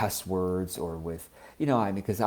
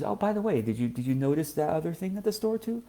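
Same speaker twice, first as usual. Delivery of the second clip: gentle high wife voice; 9 semitones higher, faster than usual. Retold or imitated. Voicing wife's gentle question.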